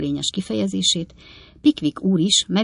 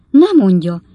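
loud cry of disbelief; astonished exclamation; 3 semitones higher, 9 dB louder than usual. A narrator's reading. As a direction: burst out in disbelief. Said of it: "kiáltotta Pickwick úr".

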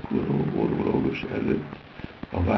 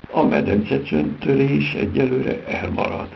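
loud, higher, firm verdict; firm clinical pronouncement, raised and loud; medical decisiveness; 9 semitones higher, 5 dB louder than usual. A narrator's reading. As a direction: declare it like a decisive doctor. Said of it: "szólt medikus határozottsággal József".

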